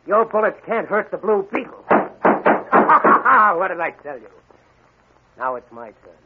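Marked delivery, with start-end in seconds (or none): 1.9-3.5 loud booming hero laugh; villainous laugh. Acted. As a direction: laugh off their bullets.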